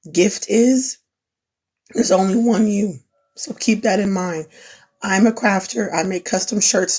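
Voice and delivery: usual speaking voice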